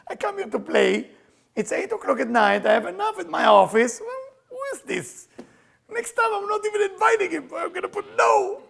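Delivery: hammed-up tennis partner complaining about him; imagined annoyed partner. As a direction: play her huffy, offended reply. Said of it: "Or she think".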